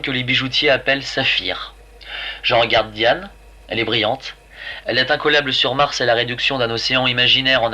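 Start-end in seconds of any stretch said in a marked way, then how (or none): none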